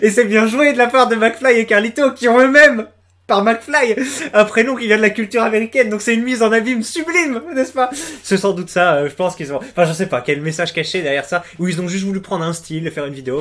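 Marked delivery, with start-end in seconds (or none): 0.0-7.9 monter vers une exaltation feinte, plus aigu et fort; emphase pompeuse moqueuse. Pretend. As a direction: joue le critique exalté, pour rire. Parodie d'analyse pompeuse du clip. (analyse pompeuse parodique)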